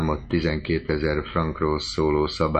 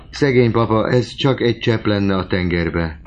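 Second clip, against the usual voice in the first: high, loud pitying lament; pitying sigh; 5 semitones higher, 5 dB louder than usual. A narrator's reading. Countess laments for her father, higher and louder. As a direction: pity the poor old man.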